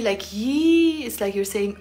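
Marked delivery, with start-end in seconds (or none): 0.3-1.0 high drawn-out yi; long surprised yi. Shown as a demonstration. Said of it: "if you say it short".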